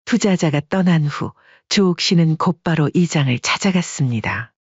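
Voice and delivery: usual speaking voice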